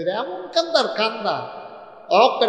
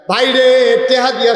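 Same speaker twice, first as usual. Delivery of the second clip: calling out; 7 semitones higher, 8 dB louder than usual. Real, not acted. Preacher calls out to crowd.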